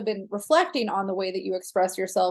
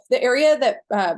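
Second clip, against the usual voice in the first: words cut by a burp; 3 semitones higher, 6 dB louder than usual. Real, not acted. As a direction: talk through a small burp. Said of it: "sorry, I'm burping".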